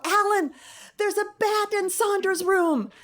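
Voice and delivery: high-pitched, urgent storyteller's yell; retold yell. Mother yelling to wake her sleeping husband, retold yell. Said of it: "and I yell".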